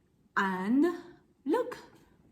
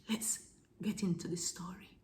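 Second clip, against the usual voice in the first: hushed whisper; 2 semitones lower, 9 dB quieter than usual. Acted. Teacher to children starting the story, hushed whisper. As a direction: whisper it like a secret to kids.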